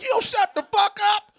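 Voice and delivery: high, loud, laughing shout; exasperated yell. Retold or imitated. Telling his friend to shut up, high laughing shout. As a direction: yell him down through laughter.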